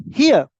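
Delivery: "Here" punched high and loud; one loud, high, falling "Here"; stress on HERE. Shown as a demonstration. Teacher demonstrating stress on here, HERE punched. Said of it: "Stress on here".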